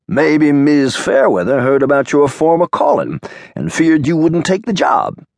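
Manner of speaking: lightly voiced Boz with a gleam of mischief; Boz teasing.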